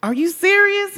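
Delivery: a high, astonished question; judge's high disbelief. Retold or imitated. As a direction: ask it as a judge who can't believe what he read. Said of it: "it was like".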